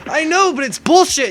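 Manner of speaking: high, loud mock whine; worked-up protest. Student protesting to calming friend, mock outrage.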